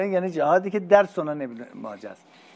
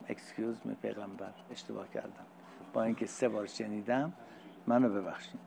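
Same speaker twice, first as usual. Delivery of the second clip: much softer, lower mock apology; sheepish apology; 5 semitones lower, 12 dB quieter than usual. Man apologizing to the Prophet, a hint of sheepish. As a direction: mumble an embarrassed sorry.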